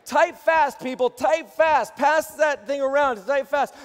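loud, high, pushing the crowd; excited urging. Real, not acted. Pastor urging online viewers to type, excited and loud. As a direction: fire them up to type. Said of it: "urging viewers excitedly".

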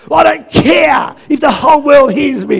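a husband shouting that he doesn't care who hears; retold angry shout. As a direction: bellow it like losing your temper at home. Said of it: "Love, you just got angry at the kids".

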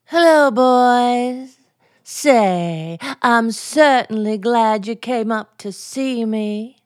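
seductive vamp. Pretend. Wife welcoming her husband's friends upstairs, as a seductive vamp.

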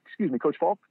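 high polite call-out. Retold or imitated. Fan calling to a star across the field, high and polite.

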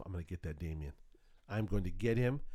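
low, hushed vow of revenge; muttered to himself. Retold or imitated. Dad plotting to get his son back, muttering to himself. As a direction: mutter a mock threat to yourself. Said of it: "I was saying to myself".

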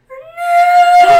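very high, loud drawn-out scream; mock anguished wail. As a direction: imitate a man's hammed-up despair over his avocado. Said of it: "the guy's face, he was like".

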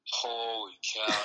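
slow, drawn-out "holy cow"; retold amazement. Hunter looking through the scope at bucks, drawn-out amazement. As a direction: imitate a hunter stunned by the view. Retold awe at spotting a buck.

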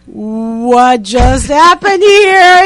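slow, dazed, asking herself; drawn out in disbelief; stunned inner voice. Retold or imitated. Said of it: "in my head".

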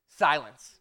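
ordinary talk

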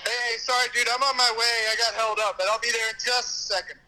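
phone call from a noisy bar; talking over bar noise on the phone; in a crowded bar. Real, not acted. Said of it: "I'm at a bar right now".

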